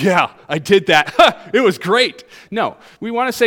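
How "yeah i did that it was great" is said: louder, laughing mock boast; mock unrepentant. Preacher mocking a fake apology with a laughing boast. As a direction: brag about the wrong with a laugh. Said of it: "I'll be like".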